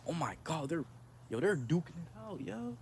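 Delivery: ordinary talk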